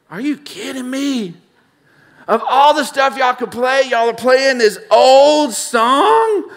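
high, loud, exasperated mock complaint; exasperated disbelief. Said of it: "And I'm like".

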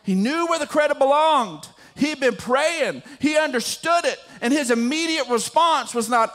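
fervent, high-pitched sermon delivery; impassioned preaching. Real, not acted. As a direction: drive the point home to a congregation. Preaches the point at full force.

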